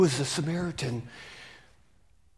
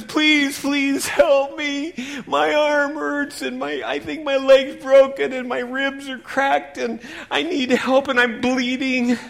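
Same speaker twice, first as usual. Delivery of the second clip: high, loud, slow desperate begging; pleading cry; 9 semitones higher, 7 dB louder, slower than usual. Acted. Beaten man begging the Levite, high and desperate. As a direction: plead for your life.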